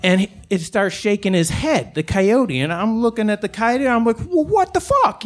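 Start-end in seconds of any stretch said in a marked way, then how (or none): 4.2-5.2 high-pitched, incredulous challenge; indignant disbelief. Retold or imitated. Man challenges a staring coyote, indignant. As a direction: throw it back, affronted and much higher. Acted startled reply to the coyote.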